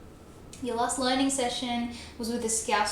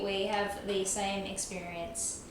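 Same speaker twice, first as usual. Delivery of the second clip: usual speaking voice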